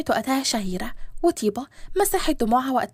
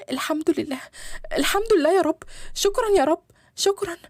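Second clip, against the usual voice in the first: high, overwhelmed thanks, building; tearful gratitude; 4 semitones higher than usual. Acted. Daughter thanking God that her father walks, tearfully grateful. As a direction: pour out thanks, moved to tears. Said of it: "فضلت تعيط".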